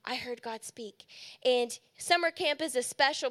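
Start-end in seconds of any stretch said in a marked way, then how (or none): 0.0-1.1 very soft, hushed retelling; tearful awe, imitated. Preacher quoting campers who first heard God, hushed and moved. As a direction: quote them barely above a murmur. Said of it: "with tears in their eyes".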